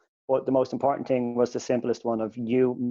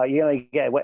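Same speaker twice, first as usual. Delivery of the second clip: normal talking voice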